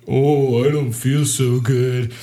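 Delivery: put-on slow, queasy kid voice; belly ache whine. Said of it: "kid with a belly ache, like".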